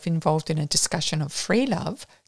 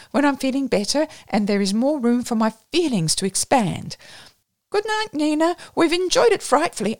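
a touch higher and louder, breezy apology; lightly voiced gushing woman; 6 semitones higher, 3 dB louder than usual. A narrator's reading. Marjorie apologises to the singer, lightly gushing and breezy. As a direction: bustle out of the room with a mock-contrite gush.

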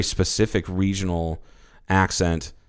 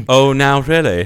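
slow, loud mock Southern drawl, pitched up; Southern accent; 5 semitones higher, 7 dB louder than usual. Southerner answering the insulting New Yorker, Southern accent. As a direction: drawl it back like an affronted Southerner.

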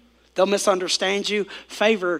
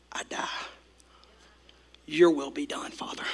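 hushed surrender; 4 semitones lower, 8 dB quieter than usual. Acted. Quoting Esther's surrender prayer.

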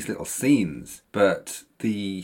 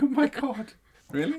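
mock disbelief; 14 semitones higher than usual. Mock disbelief at throwaway line.

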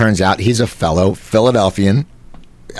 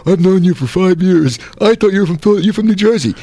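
offended buddy; 10 semitones higher than usual. Retold or imitated. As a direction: play the hurt friend who feels lied to, voice pushed up. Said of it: "my buddy was all offended".

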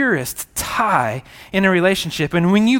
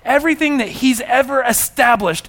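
loud, higher fervent preaching; preaching at full force; 6 semitones higher, 6 dB louder than usual. Real, not acted. Preacher exhorting congregation about the cross, fervent and loud. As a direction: build the sermon to a ringing peak.

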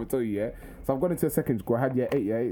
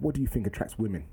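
lower, precise pupil voice; matter-of-fact, well-spoken kid, pitched down; formal schoolboy impression; 5 semitones lower than usual. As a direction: mimic an oddly proper schoolboy. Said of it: "How comes you talk like this?".

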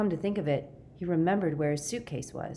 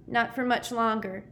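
high-pitched misery; wretched, higher-voiced boy; miserable; 7 semitones higher than usual. A narrator's reading. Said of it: "Sticky said miserably".

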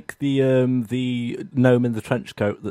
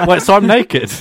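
laughing, louder and higher; amused realisation; 5 semitones higher, 5 dB louder than usual. Real, not acted. Player learns his inventory is empty, laughing. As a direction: say it through real laughter.

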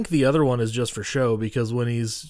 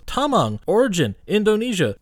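higher, stiff robotic announcement; playful computer voice, much higher; mock computer readout; 8 semitones higher than usual. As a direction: read it like a gadget's readout. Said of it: "Cal goes".

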